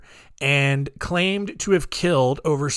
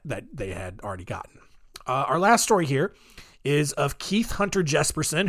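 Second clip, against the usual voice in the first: usual speaking voice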